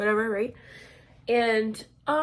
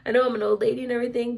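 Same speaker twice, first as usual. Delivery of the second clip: rushed out, louder; fast emphatic; 6 dB louder, much faster than usual. Retold or imitated. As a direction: push the words out quickly and firmly. Repeats the line, pushier.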